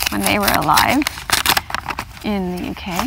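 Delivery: ordinary delivery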